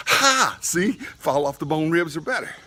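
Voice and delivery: high, loud mock triumph; gloating wife. Wife rubbing it in to her husband, mock gloating. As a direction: crow it as the wife proven right. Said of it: "And my wife goes".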